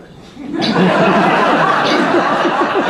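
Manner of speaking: a burst of airy laughter at the punchline; real laughter. Real, not acted. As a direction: crack up at your own joke. Laughs at own joke's punchline.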